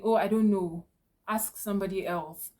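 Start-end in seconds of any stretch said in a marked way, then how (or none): 0.0-0.9 a careless, louder "Oh, I don't know" that shrugs the customer off; dismissive brush-off. Shown as a demonstration. Clerk brushing off an upset customer's question, dismissive. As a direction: toss "Oh, I don't know" away like it's not your problem. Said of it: "replying their questions and saying".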